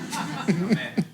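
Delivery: a quiet chuckle, breathy and short; soft laugh. Real, not acted. Preacher chuckling softly at the congregation. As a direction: let out a little laugh.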